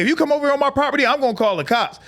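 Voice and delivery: fast, high, indignant warning; rushed high-pitched ultimatum; mock threat. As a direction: imitate a flustered old lady threatening. Acted neighbour's threat in quarrel.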